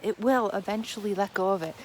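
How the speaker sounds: ordinary delivery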